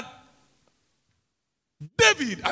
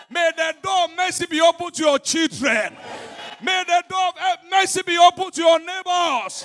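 full-force preaching; 6 semitones higher than usual. Acted. Pastor drives home seeing as God sees, full-force preaching. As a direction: hammer each phrase home to the congregation.

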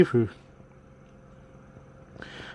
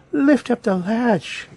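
put-on high, very slow old-lady voice; grandmother crying out; 7 semitones higher, much slower than usual. A narrator's reading. Grandmother calling to her visitor, high slow cry. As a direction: call it out high and drawn-out. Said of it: "cried the grandmother".

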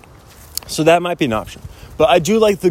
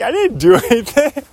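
mock protest; 9 semitones higher than usual. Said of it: "I'm like".

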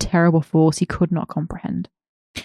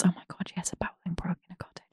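fast excited whisper; hushed gleeful aside; 15 dB quieter, faster than usual. Real, not acted. Delighted aside while reading.

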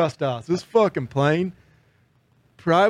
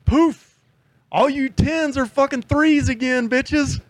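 much higher, slow mocking voice; mocking dude; 10 semitones higher, slower than usual. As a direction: taunt the women in a high sneering tone. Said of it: "He goes".

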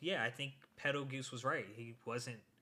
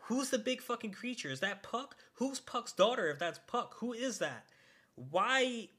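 higher, louder, rapid baffled questions; excited rant; 8 semitones higher, 5 dB louder than usual. Real, not acted. Fan venting to friend about baffling plot, high excited rant. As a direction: pile up the questions, overwhelmed.

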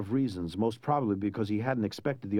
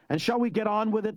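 impatient taunt; 10 semitones higher than usual. Acted. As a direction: hurry him along with scorn. Prince impatient, mocking.

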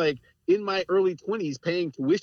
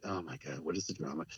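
much lower and far softer, an exasperated aside under the breath; 11 semitones lower, 14 dB quieter than usual. Retold or imitated. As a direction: grumble it almost to yourself, fed up with the drama. Exasperated at mother's drama.

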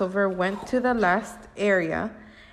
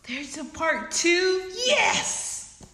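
excited squeal; 10 semitones higher, slower than usual. Acted. Excited reaction to sequel.

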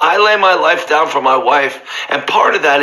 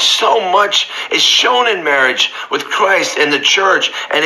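fervent preaching; 5 semitones higher than usual. Acted. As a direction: lift it up and savour each phrase. Preacher rising to fervent climax.

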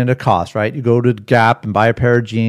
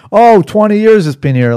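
much higher, louder boastful sing-song; song and dance; 10 semitones higher, 8 dB louder than usual. Retold or imitated. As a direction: oversell the lie like a showman. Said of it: "singing a song and dance".

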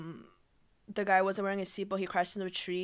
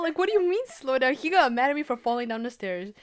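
usual speaking voice

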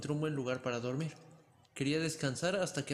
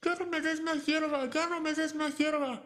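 high, slow, desperate yell; terrified shout; 13 semitones higher, 4 dB louder than usual. A narrator's reading. Pulgarcito trapped in the cow's belly, terrified high yell. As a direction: scream it out in fear. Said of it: "Pulgarcito volvió a gritar".

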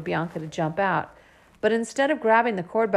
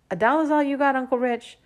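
mocking kid; 8 semitones higher, 5 dB louder than usual. A narrator's reading. Kid mocking uncle's dollar.

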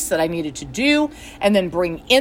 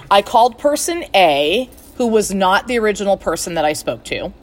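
usual speaking voice

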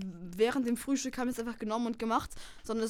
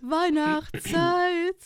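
stretched jingle; 4 semitones higher, much slower than usual. Shown as a demonstration. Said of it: "mit deiner dunkelsten Stimme".

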